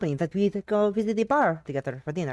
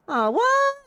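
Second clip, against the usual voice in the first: high, loud mock gasp of surprise; a squeal of feigned shock at her leaving; 5 dB louder than usual. Teasing friend feigns surprise.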